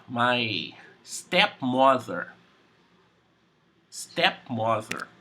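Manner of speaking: stepmother said slowly and carefully, x0.5; slow new word. Shown as a demonstration. Teacher slowly introducing a new word to student. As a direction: sound out "stepmother" slowly for a learner. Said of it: "new word for you".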